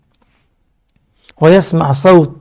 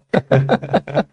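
quiet, low laugh; soft chuckle; 4 semitones lower, 7 dB quieter than usual. Real, not acted. Preacher retelling the hadith of laughter, chuckling quietly. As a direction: chuckle softly to oneself.

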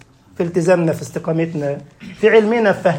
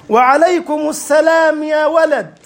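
high, slow, theatrical exchange; father and son joking in formal Arabic; hammed-up classical dialogue; 7 semitones higher, slower than usual. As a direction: play it like a solemn old-fashioned play. Father and son trade mock classical lines.

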